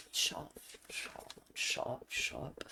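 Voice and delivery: very soft, unvoiced whisper; whispered retort. Real, not acted. Streamer answering teasing about the knee, whispering. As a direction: whisper it over and over under the breath.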